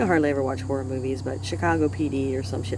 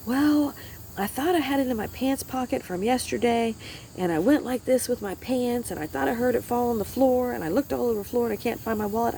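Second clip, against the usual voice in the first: husband's voice, higher and fretful; frantic husband; 7 semitones higher than usual. Retold or imitated. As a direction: play the flustered husband explaining fast. Voicing husband's frantic explanation.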